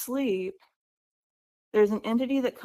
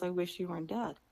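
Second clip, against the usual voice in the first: quiet, softer lines, grieving brother; 2 semitones lower, 9 dB quieter than usual. Retold or imitated. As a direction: say it softly through held-back tears. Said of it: "he's beginning to cry".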